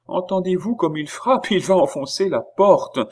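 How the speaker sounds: high, rising panic; frantic warning, pitched well up; alarmed. A narrator's reading. Oliva hears her lover pounding the door, alarmed.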